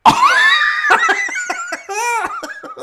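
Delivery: long high-pitched cackle; real laughing fit. Real, not acted. Host cracking up at the crash clip, high laughter. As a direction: crack up helplessly.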